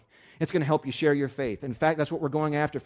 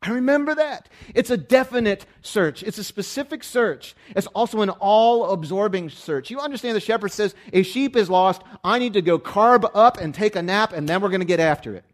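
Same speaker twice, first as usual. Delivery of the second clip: loud, high, driving sermon delivery; full-throated pulpit preaching; preaching at full force; 7 semitones higher, 9 dB louder than usual. Acted. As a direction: preach it hard to the room.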